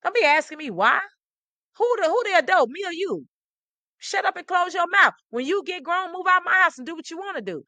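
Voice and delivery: put-on high, loud old-school parent snapping back; scolding parent. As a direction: scold like a strict parent shutting the kid down. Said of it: "they would say".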